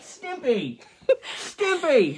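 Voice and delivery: high-pitched mock-British, breaking into laughs; silly, high British-accent attempt. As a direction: try British and crack up. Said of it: "I'm gonna try to do".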